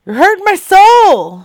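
high, loud wounded cry; over-the-top hurt holler; mock anguished wail. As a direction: wail it out, pitch leaping up. Complaint escalates to a wail.

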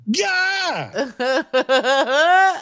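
high-pitched comic yelp of shock; startled mock yell. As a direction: yelp as if caught off guard by the sight. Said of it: "and you're like".

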